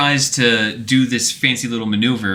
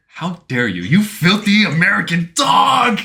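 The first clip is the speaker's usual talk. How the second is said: Ocelot outraged; 6 semitones higher than usual. Retold or imitated. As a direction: start offended, then spit the insult out. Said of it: "Ocelot's like".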